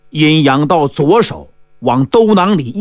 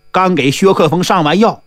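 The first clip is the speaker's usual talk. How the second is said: plain reading voice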